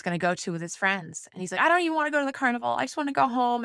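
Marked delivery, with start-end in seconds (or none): 1.6-3.6 high, rushed mock-upset kid; imitating upset son. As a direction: imitate a child on the verge of tears, high and hurried. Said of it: "He was crying".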